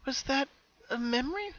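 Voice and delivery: high, hushed, puzzled question to herself; wondering aloud. A narrator's reading. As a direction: half-whisper the doubt. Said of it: "she asked herself".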